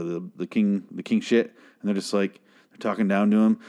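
normal talking voice

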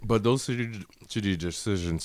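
talking through a mouthful of chips; words round a mouthful of chips; mouth full. Real, not acted. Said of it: "chips in my mouth".

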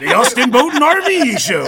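high, booming commercial announcer; big, loud hype pitch; RV show announcer. Shown as a demonstration. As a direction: bellow it like a boat show ad. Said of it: "channel the R V guy".

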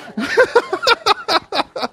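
high burst of laughter at own joke; real laugh. Real, not acted.

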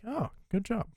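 high, soft mock praise; a squeaky, quiet "good job"; Holt impression. Quoting Holt.

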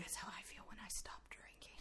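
very soft, breath-only confession; hushed joking aside; whispered aside. Real, not acted. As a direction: slip it in under your breath.

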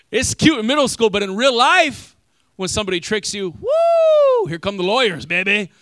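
loud, high, punchy build to a shout; hyped-up preaching. Acted. Pastor firing up the congregation, loud and high. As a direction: whip the crowd up with it.

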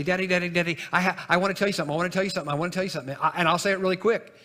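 blurting it out like an eager little daughter; quick excited child. Retold or imitated. Daughter rushing to tell her daddy something, fast and eager. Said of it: "she said very quickly".

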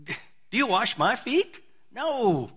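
drawn-out disbelieving protest; Lord, come on, said in slow disbelief; Peter's incredulous tone. Retold or imitated. Peter protesting to Jesus, slow incredulous whine. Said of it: "you gotta hear Peter's tone here".

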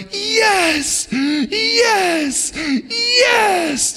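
high squealing excited yes; imitating children's ecstatic cheer; kids cheering yes. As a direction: shriek it like a thrilled kid. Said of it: "just like that".

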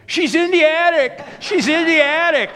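loud, high shouted warning; audience member shouting. Retold or imitated. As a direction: yell it out from the crowd in alarm. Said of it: "somebody in the crowd shouted out".

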